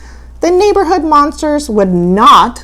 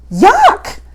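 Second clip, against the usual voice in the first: monster yell; 9 semitones higher, 4 dB louder than usual. Acted. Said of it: "The monster yelled".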